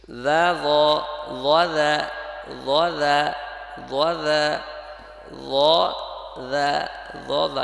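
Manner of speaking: loud, held দা then heavy rounded ল; slow pronunciation drill. Shown as a demonstration. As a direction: separate দা and ল deliberately for learners. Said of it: "যেমন".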